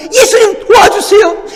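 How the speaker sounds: high, loud begging cry; desperate pleading. Acted. Woman kneeling before Jesus for her daughter, desperate pleading. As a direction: implore at the top of your voice. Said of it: "무릎 꿇고 간청을 하는".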